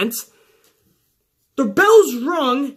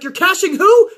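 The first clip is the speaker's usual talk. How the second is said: higher, louder puzzled question; confused referee; 7 semitones higher, 5 dB louder than usual. Retold or imitated. Referee mishears Carmella, high and puzzled. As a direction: play the ref who has no clue. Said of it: "he's like".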